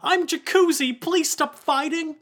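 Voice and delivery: put-on high, slow little kid's plea; squeaky-high young Jacuzzi; young boy voice. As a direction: plead like a child, high-pitched. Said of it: "who's this young voice".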